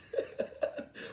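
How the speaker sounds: short unvoiced chuckle; breathy laugh. Real, not acted. Laughs at his dark side.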